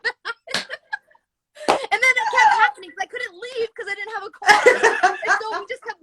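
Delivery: words squeezed through laughter; giggling over an embarrassing memory; laughing while telling. Real, not acted.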